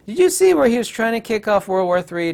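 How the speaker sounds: ordinary talk, nothing marked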